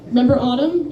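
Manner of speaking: usual speaking voice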